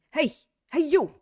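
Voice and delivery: loud, high New York call; New York accent. Shown as a demonstration. Teacher playing a local accosting someone, New York accent. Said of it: "say it to yourself in a New York accent".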